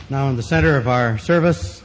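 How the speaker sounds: usual speaking voice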